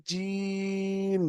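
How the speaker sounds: very slow recitation of letter names; drawn-out letter recitation. Shown as a demonstration. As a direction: stretch each letter name long and sung. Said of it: "দেখেছেন".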